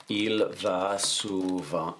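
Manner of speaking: drawn-out French at typing speed; slow dictation. Shown as a demonstration. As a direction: say each word slowly while typing it. Retyping the sentence aloud.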